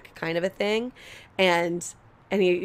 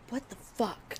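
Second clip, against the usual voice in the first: mouthed disbelief; 4 semitones higher, 12 dB quieter, slower than usual. Retold or imitated. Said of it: "his face was just like".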